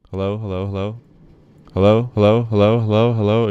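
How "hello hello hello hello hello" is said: much louder, pushing the meter; loud mic test. Shown as a demonstration. Streamer testing whether his loud voice clips, loud. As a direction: belt each hello into the mic. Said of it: "It goes up to the red if I go down".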